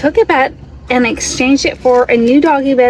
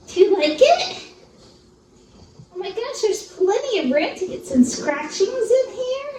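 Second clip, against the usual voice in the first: higher, softer cooing; pet talk; 6 semitones higher, 5 dB quieter than usual. Real, not acted. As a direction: coo at the dog. Talking to dog.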